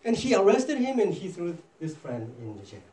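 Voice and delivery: usual speaking voice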